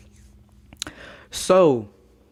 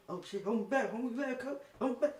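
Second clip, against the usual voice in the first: mock hold me back; 8 semitones higher, 10 dB quieter, faster than usual. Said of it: "I'm like".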